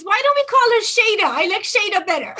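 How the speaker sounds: high, loud, quick mock aunt voice; aunt's voice. Aunt proposing a new name to the family, high and quick. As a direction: chirp it out fast like the excited aunt. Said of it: "she goes".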